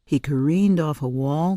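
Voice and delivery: ordinary delivery, nothing marked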